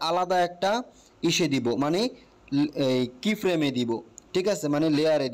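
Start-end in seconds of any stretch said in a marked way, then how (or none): none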